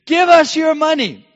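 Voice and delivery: high, loud demanding yell; thieves' shout. Retold or imitated. Robber shouting at the couple for money, loud and high. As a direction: bark the demand like a crazed robber. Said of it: "they're shouting kind of in this drug-fueled craze".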